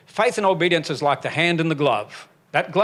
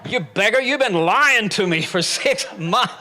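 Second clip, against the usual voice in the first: mock indignation; 6 semitones higher than usual. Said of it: "I thought".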